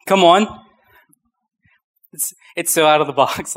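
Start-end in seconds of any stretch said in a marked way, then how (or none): 0.1-0.5 rising, louder call to the crowd; hyped call. Real, not acted. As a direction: urge the room on. Preacher calling to congregation.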